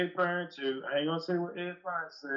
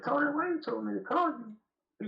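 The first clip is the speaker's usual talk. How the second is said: imitating Carl; 9 semitones higher, slower than usual. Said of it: "he said".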